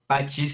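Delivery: pacis said "PA-chis"; Latin pronunciation. Shown as a demonstration. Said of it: "se pronunciaría pacis".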